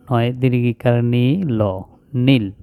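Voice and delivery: ordinary talk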